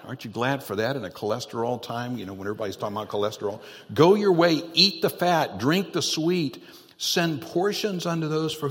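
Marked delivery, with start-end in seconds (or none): 3.9-8.7 loud, high preaching shout; shouted scripture. Acted. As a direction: belt the verse out to the back row. Preacher repeats verse, far louder.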